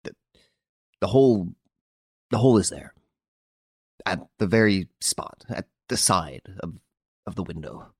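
stammering. A narrator's reading. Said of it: "He stammered".

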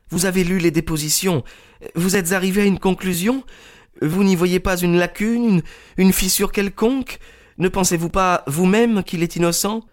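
put-on high, earnest young woman's voice; high, insistent young woman's voice; young Miss Turner. A narrator's reading. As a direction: plead passionately for the man she loves. Turner presses Holmes.

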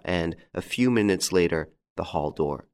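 plain reading voice

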